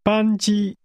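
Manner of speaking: 班机 with both syllables held high and level; two high-level tones. Shown as a demonstration. Teacher models flight for learners, two high-level tones on 班机. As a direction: say 班机 on a steady, high pitch, no rise or fall. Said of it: "That's two high-level tones".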